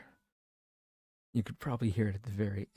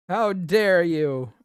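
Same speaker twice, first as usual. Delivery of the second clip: mock outrage; 9 semitones higher, 10 dB louder, slower than usual. Mock outrage, loud, slow.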